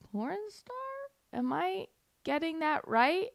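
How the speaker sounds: high, soft questioning lilt; mock puzzlement. Host doubting the victim story, mock puzzled. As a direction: query it softly as if baffled. Feigned puzzlement at the absurd claim.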